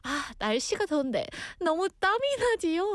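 quick, bright small-talk opener; chatty weather remark; friendly small talk. Shown as a demonstration. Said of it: "어, 이런 식으로".

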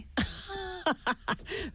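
short burst of laughter at own boring lecture. Real, not acted.

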